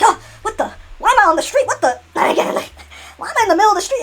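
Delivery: high, loud bewilderment; confused waking. Acted. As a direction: yelp in confusion. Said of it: "It's like".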